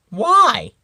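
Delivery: high, loud surprised question; a squeaky little why; asked. A narrator's reading. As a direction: circle asking, high and loud. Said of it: "asked one of the circles".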